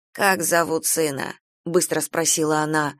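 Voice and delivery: usual speaking voice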